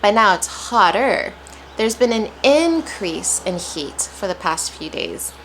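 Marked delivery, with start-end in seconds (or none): 1.8-5.3 increase said IN-crease with a strong IN; heavy stress on IN. Shown as a demonstration. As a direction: hit IN in increase like a warning. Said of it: "I'm placing a lot of stress".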